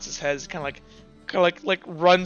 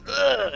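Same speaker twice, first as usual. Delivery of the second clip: higher, acted "ugh" of distaste; disgusted groan; 7 semitones higher than usual. Lackey gingerly handling bloody gear, acted disgusted groan. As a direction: groan squeamishly. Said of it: "he's like".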